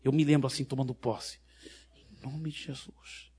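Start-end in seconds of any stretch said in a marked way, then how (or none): 1.9-3.3 hushed, slow murmur to oneself; silent inner declaration. Retold or imitated. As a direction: say it softly, as if inside the heart. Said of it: "não falei nada em voz alta".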